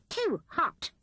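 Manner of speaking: high squeaked complaint; muffled squeak. A narrator's reading. Rat with his nose in hot jam, squeaking. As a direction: squeak it out as if burnt. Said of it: "he squeaked in a muffled voice".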